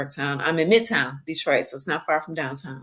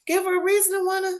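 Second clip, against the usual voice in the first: excited pitch; 12 semitones higher, faster than usual. Retold or imitated. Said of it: "I was like".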